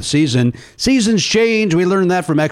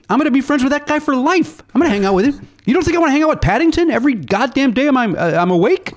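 excited gushing; 12 semitones higher than usual. Real, not acted. Gushing about befriending talking bears.

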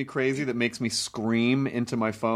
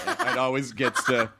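usual speaking voice

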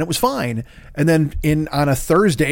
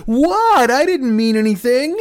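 much higher, put-on innocent voice; mock innocent Chrissy Teigen; 10 semitones higher, slower than usual. Mocking a celebrity who feigns innocence, high and innocent. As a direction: play dumb in a squeaky high voice. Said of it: "then she'll be like".

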